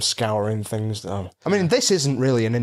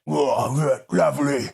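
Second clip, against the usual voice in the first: put-on cockney accent; 3 semitones higher than usual. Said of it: "I did a cockney voice".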